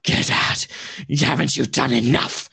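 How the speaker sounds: cold hissing rasp. Acted. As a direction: rasp it out like a lurking wraith. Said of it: "this kind of rasping voice".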